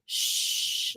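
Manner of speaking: soft whispered shush; a quiet toddler shush. Shown as a demonstration. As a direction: hush him gently, breath only. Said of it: "So we say".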